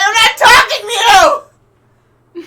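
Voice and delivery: very high, loud shout through laughter; high-pitched bellowed retort; exasperated yell. Acted. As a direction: scream it back across the gap. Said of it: "Why are you yelling?".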